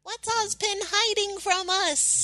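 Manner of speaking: very high, slow mock voice; put-on questioning voice. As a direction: pitch it way up and draw out the question. Acting out the question to the genie.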